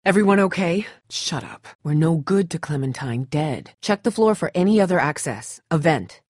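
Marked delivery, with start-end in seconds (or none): none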